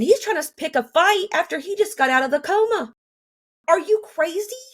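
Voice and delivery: high-pitched incredulous outburst; indignant disbelief. Real, not acted. On him picking a fight after coma, indignant. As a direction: pitch climbing into an outraged question.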